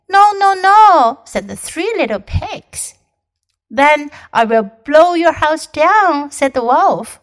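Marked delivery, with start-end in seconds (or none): 0.1-1.2 high, loud, slow little pigs. Acted. Three pigs refusing. 3.7-6.3 loud big bad wolf. Wolf threatening.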